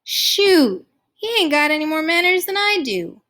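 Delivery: high, loud scoffing objection; startled. A narrator's reading. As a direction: burst out in startled disagreement. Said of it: "I said, startled".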